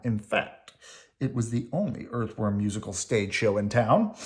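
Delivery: plain reading voice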